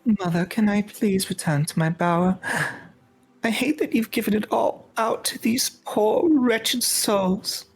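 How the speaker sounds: put-on high-pitched sulky young noble; over-the-top petulant daughter. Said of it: "over-the-top sigh".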